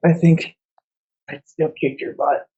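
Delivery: weakly amused, wounded teasing with a pause; slight laugh. Acted. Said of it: "she kinda laughs and is like".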